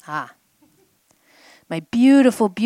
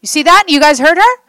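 excited; 9 semitones higher, 14 dB louder than usual. Real, not acted. Excited at student's breakthrough.

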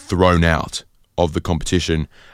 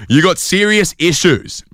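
louder, slow, higher mock snap; snapping at Manu; 11 semitones higher, 4 dB louder, slower than usual. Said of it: "she finally snaps and she says".

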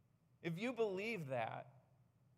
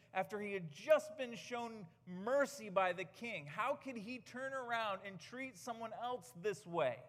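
ordinary delivery

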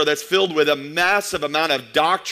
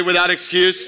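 call to repeat. Shown as a demonstration. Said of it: "Can you say".

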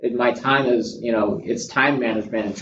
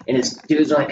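normal talking voice

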